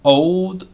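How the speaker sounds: put-on OLD said so its sound gives the meaning; word-painting. Shown as a demonstration. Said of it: "bring out the meaning of the word by its sound".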